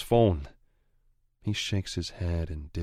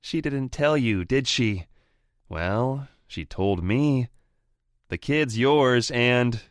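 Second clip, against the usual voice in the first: higher, louder cocky sneer; gloating rival voice; 6 semitones higher, 6 dB louder than usual. Acted. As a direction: rub it in his face. Anton taunts with secret.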